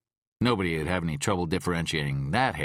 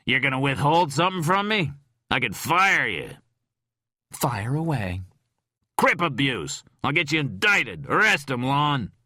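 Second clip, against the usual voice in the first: loud, higher raging threats; furious drunk; 9 semitones higher, 5 dB louder than usual. A narrator's reading. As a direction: rant at him. Drunk Rhyme rages at Tom.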